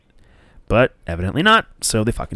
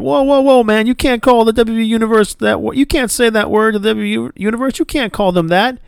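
hammed-up alarmed protest, pitched way up; mock Titus; 11 semitones higher than usual. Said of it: "Titus is like".

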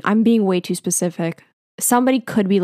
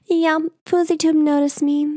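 put-on bragging kid; 8 semitones higher than usual.

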